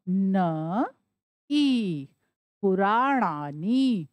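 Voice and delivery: पुराणानी spoken slowly, syllable by syllable; slow reveal of the word. Shown as a demonstration. Teacher to students after spelling it out, slowly. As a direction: draw out पुराणानी so it can be copied. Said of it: "और फिर क्या है?".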